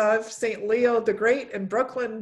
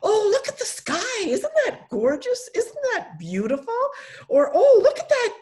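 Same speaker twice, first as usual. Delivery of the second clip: much higher, gushing with delight; excited mom voice; 4 dB louder than usual. Retold or imitated. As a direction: point out wonder to a toddler. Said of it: "I say".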